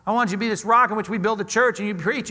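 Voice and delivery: normal talking voice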